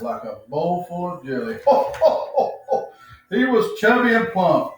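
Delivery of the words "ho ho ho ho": high, louder jolly ho ho; belly-shaking Santa laugh. Acted. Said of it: "shook when he laughed".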